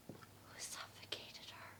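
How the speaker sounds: slow hushed whisper. Acted. Woman asking about a suffocated mother in a whisper. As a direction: lean in and whisper it, shocked.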